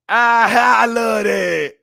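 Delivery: loud, high laughing outburst; high shouted laugh of joy. Real, not acted. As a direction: howl with delight. Delighted reaction to chat comment.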